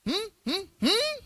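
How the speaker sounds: very high, questioning hums; mimicked wordless signal. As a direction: copy the principal's hums back. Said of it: "saya jawab pakai bahasanya dia". (membalas dengan dengungan tinggi)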